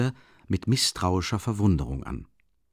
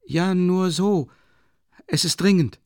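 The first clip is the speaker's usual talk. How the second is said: vague; 10 semitones higher, 5 dB louder than usual. A narrator's reading. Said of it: "antwortete er unklar".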